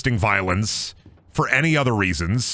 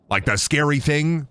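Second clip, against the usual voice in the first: usual speaking voice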